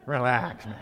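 soft, low calming aside; mild hushed relax, man. Retold or imitated. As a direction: quietly tell your friend to cool it. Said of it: "I go".

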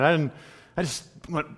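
ordinary talk

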